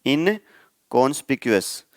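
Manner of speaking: "इनकॉन्स्पिक्यूअस" said slowly, every syllable clear; careful pronunciation. Shown as a demonstration. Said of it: "थोड़ा typical सा word है".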